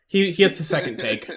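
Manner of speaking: giggling through words; laughing while speaking. Real, not acted. Laughs at retake joke.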